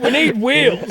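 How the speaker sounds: high, loud imitation of a mate; urgent mock demand. Friend rousing a drunk mate to fetch the car, urgent.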